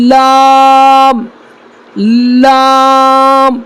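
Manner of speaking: لام held very long; slow model of لام; drawn-out letter demo. Shown as a demonstration. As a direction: stretch لام slowly. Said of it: "اگلا حرف کیا ہے".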